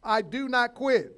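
loud, slow, each word planted; declaration. Shown as a demonstration. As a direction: declare it for the church to repeat. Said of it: "say it with me".

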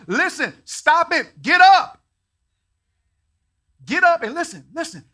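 very loud, high, pleading shout; shouted exhortation. Acted. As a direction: rouse the crowd to their feet. Preacher exhorting congregation.

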